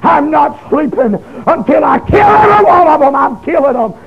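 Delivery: rising shouted oath of revenge; angry vow. Retold or imitated. Boy vowing revenge to his drunk daddy, shouting. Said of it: "And I said".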